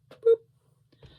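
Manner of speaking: high squeaky boop; playful boop. Acted. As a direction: pop out a cute high boop. Playful sound while folding cuff.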